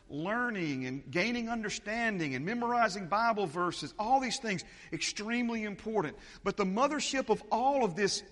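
fired-up sermon delivery; preaching. Acted. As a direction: preach it with rising force. Preaching at full force.

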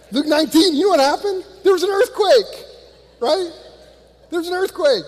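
preacher to congregation, fired-up excitement; excited preaching. Real, not acted. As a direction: ramp up the excitement about the earthquake. Preacher building to the earthquake.

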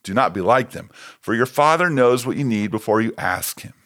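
ordinary talk, nothing marked

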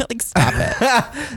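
slow mock witch voice, laughing; a light cackling witch telling them to stop; old hag. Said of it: "that's probably more accurate".

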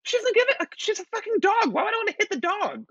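very high, incredulous squeal of protest; exasperated. Retold or imitated. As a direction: protest in disbelief, voice shooting right up. Indignantly repeating own protest to ex.

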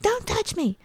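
high-pitched mock tantrum protest; imitated child's yell. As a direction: mimic an upset child snapping, without much volume. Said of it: "then she was yelling at me".

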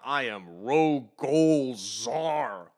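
slow, drawn-out villain boast; a hint of a hammy villain. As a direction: announce the name grandly. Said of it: "the alopecia-ridden fish is like".

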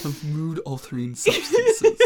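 slow echo dissolving into laughter; laughing. Real, not acted. Echoing co-host's careful wording about drugs, laughing. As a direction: repeat it slowly while cracking up. Echoes co-host's phrase while cracking up.

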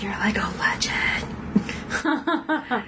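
slow, breathless awed whisper; slow reverent fan whisper; starstruck whisper. Retold or imitated. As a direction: hush it like meeting your idol. Starstruck cop meets Todd.

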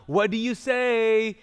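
imitated mom cue; insistent mom. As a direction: stretch it out like a mom stuck on repeat. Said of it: "the mom is stuck on".

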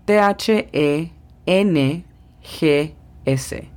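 "T H E N G S" deletreado muy despacio; spelled out slowly. Shown as a demonstration. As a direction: deletrea letra por letra. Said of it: "ponemos la lengua entre los dientes y soplamos". (deletreo lento)

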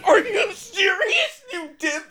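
high, slow, half-laughing cry of outrage; laughing disbelief. Retold or imitated. Viewer yelling at the show, laughing in disbelief. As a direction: shriek it through laughter at being duped. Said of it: "And you're like".

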